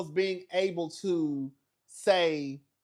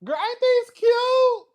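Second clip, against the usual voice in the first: very high, slow, loud squeal; a loud, slow, high-pitched delight; drawn-out gush; 9 dB louder, slower than usual. Real, not acted. Showing off shoes.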